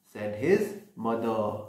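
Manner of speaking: ordinary delivery, nothing marked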